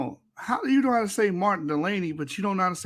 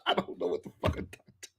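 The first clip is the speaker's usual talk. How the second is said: laughing; 5 dB quieter than usual. Real, not acted. Laughing at a comment.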